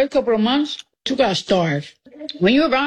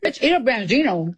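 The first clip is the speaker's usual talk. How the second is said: ordinary talk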